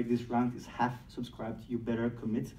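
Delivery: ordinary talk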